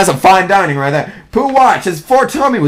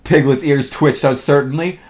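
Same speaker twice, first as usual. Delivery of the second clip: ordinary delivery, nothing marked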